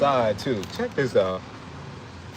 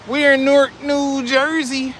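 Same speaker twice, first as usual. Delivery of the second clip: loud announcement; 9 semitones higher, 3 dB louder than usual. Real, not acted. Repeated announcement.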